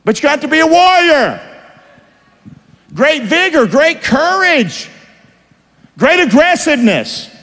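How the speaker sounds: rally them like warriors; rousing call. Real, not acted. Preacher rallying the congregation to be warriors.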